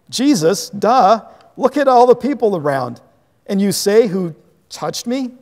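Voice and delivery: loud, high mocking jab; exasperated sarcastic disciples. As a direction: scoff at Jesus like it's obvious. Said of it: "the sarcasm in their voices".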